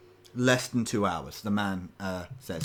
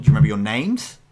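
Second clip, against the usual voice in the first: louder in-character question; 11 dB louder, faster than usual. Acted. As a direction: ask them as the man, urgently. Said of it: "out of character".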